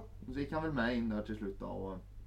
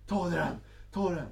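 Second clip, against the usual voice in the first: higher, louder urging; demanding woman; 7 semitones higher, 5 dB louder than usual. Retold or imitated. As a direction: push him to take it off. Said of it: "Hon bara". (hög, ivrig uppmaning)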